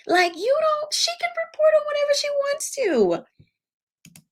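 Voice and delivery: very high, strained exasperation; exasperated high pitch. Real, not acted. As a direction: voice shooting way up in protest. Exasperated defence of her reporting.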